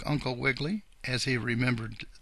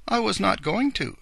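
usual speaking voice

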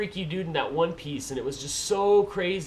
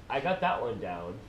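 usual speaking voice

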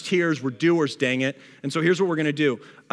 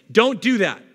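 emphatic warning; 8 semitones higher, 7 dB louder than usual. Real, not acted. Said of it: "preacher warns congregation".

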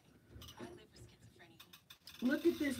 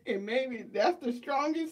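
ordinary delivery

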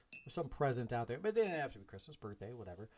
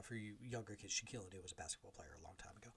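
whispered aside; 3 semitones lower, 11 dB quieter, faster than usual. Real, not acted. Quick aside to younger listeners.

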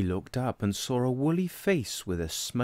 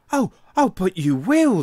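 much higher and much louder rabbit; the rabbit cheers Tadpole on, much higher and louder; excited rabbit; 10 semitones higher, 11 dB louder than usual. Acted. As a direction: burst out eagerly as the rabbit. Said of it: "said Rabbit".

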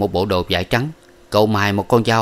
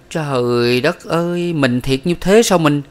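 higher and slow, aghast; despairing cry; 7 semitones higher, slower than usual. A narrator's reading. Young man cries out to Miss Ba in slow despair. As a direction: let the words drag out in dismay.

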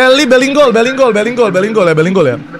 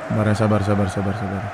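patient hush; 14 semitones lower, 12 dB quieter, faster than usual. Real, not acted. Calming himself before attacking.

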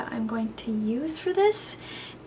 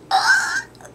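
high-pitched squeal of a laugh; squeaky laugh; 6 dB louder than usual. Real, not acted. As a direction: laugh like a dying bird. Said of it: "sound like a, a dying bird".

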